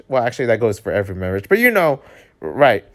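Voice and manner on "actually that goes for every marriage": ordinary delivery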